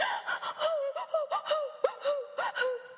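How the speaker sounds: high breathless gasp; relieved pant. Acted. Chick after escape, high breathless gasp. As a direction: gasp like a pounding heart.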